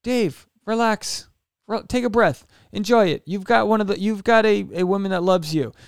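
calm inner voice. Retold or imitated. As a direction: talk yourself down like a sensible friend. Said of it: "normal side to be like".

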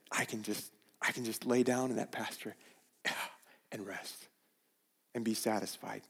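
David sighing, content in the pasture; contented rest. Retold or imitated. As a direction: breathe it out, at peace.

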